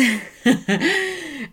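high bubbly laugh after the news; giddy laugh. Real, not acted. Vlogger to her viewers, laughing giddily after her news. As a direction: laugh it off, delighted.

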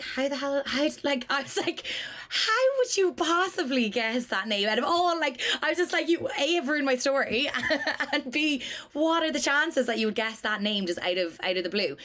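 high, stunned outrage, half laughing; incredulous disbelief. Retold or imitated. Telling a colleague she ruined the story, incredulous. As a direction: act out the total disbelief of hearing that name. Retelling her shock at the flight.

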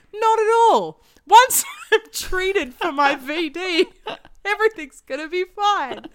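high, loud put-on girl's voice, half laughing; breezy teen-novel heroine, way over the top; mock reassurance pitched far up; hammed-up reassurance. Voices girl in cheesy book dialogue.

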